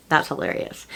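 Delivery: a soft, breathy aside, half to herself; whispered aside. Real, not acted. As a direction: whisper it softly to yourself, amused. Repeats the reaction under her breath.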